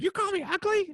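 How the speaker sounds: very high squawky mock-woman voice; imitated offended heckler. Lady heckler answering an insult, high mock outrage. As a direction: mimic her indignant question way up high.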